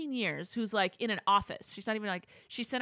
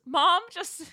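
ordinary delivery

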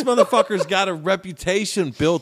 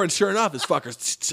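ordinary talk, nothing marked

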